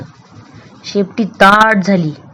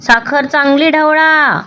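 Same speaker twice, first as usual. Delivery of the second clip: higher, slow, cross repeat; angry; 6 semitones higher than usual. Acted. Friend repeating the step, slow and cross. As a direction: snap it again, annoyed and slow. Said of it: "हसोबा रागाने म्हणाला".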